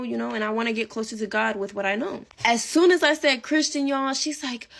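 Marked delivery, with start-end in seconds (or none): none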